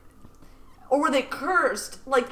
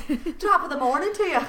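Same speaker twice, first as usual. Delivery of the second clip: mock Irish accent; 4 semitones higher, faster than usual.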